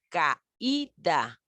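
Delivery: "caída" as ca-Í-da, slowly; CAÍDA slowed down, the Í standing apart from the A; stressed, separated vowel. Shown as a demonstration. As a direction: split "caída" into three syllables with weight on the Í. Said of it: "llevan mayor fuerza en la voz".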